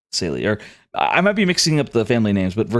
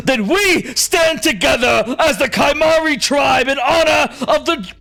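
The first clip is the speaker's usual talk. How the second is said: yelling out; 12 semitones higher than usual. Acted. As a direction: roar it as you raise a sword. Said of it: "As he yells out and raises his sword".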